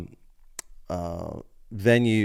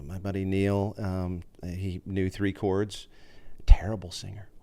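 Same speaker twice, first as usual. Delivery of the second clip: whispered aside; 5 dB quieter than usual. Real, not acted. Ribbing absent roommate Neil, hushed teasing whisper. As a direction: let it slip as a secret jab.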